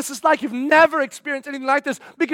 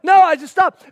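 excited interruption; 6 semitones higher, 7 dB louder than usual. Pretend. Women interrupting each other.

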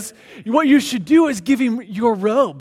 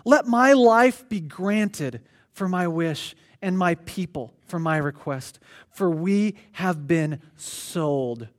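low, soft, measured Bible reading; scripture reading; 6 semitones lower, 5 dB quieter than usual. Acted. Preacher reads Esther's plea to the congregation, hushed and solemn. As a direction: read the verse solemnly to the room.

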